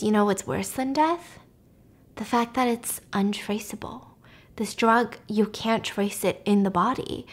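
hushed, breathless secret; villain whispering his secret plan; whispered villain line. Retold or imitated. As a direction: lean in and whisper it like a conspiracy. Villain confides secret drug plan.

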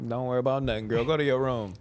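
low, soft, lightly voiced dad sending the kid off; a hint of a dad shooing his girl away; lightly voiced father; mock stern dad. Voicing a parent dismissing child.

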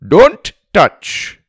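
put-on high, loud, Indian-accented order; Indian-accented warning. A narrator's reading. Elephant rider swatting boy's hand away, Indian-accented warning. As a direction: scold sharply in an Indian accent.

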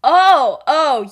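loud, rising "oh" as it clicks; sudden realisation. Acted. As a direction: act out the penny dropping. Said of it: "James's mind finally puts it together".

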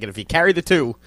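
loud, mock-confused counting through a sum; mock idiot doing math out loud; playing dumb at math. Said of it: "pretends to be a total idiot at math".

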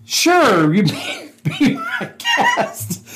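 real laughter stretching out the words; laughing through words. Real, not acted. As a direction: break into high, helpless laughter mid-sentence. Cracking up retelling his reply.

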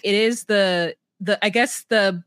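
usual speaking voice

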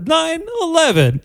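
high, stilted robotic delivery; halting robot-like Walken; Christopher Walken impression. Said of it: "I sound like some weird robot".

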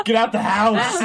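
loud laughing yell; laughing shout. Pretend. Mock horror-movie warning.

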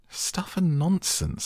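high, drawn-out indignant exclamation; loud protest. A narrator's reading. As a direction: dismiss it in a high, slow outcry. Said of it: "said Alice loudly".

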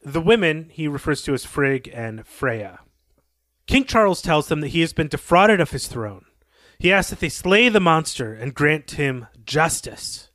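usual speaking voice

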